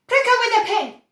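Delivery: high, loud call. A narrator's reading. Another father calling out across the room, loud. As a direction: call out over the din.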